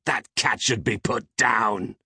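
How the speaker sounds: high-pitched bitter outburst, drawn out slowly; slow, bitter protest. A narrator's reading. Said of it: "Peg's bitter mumble".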